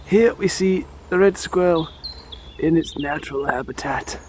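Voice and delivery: hushed wildlife presenter voice; nature documentary. Pretend. Mock wildlife narration.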